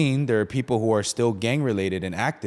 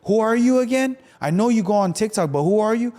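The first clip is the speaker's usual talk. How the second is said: high, louder, eager questions; excited fan; 10 semitones higher, 5 dB louder than usual. Retold or imitated. A fan who knows him from TikTok, high and excited. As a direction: gush like a starstruck fan.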